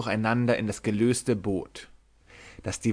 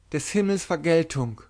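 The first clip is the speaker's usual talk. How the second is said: groaning; 6 semitones higher than usual. A narrator's reading. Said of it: "stöhnte das Weib ungehört".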